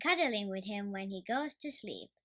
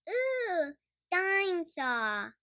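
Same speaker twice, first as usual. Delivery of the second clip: put-on much higher, slow toddler piglet voice; a tiny boy drawn out in wonder; George voice; 8 semitones higher, 3 dB louder, slower than usual. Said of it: "says George".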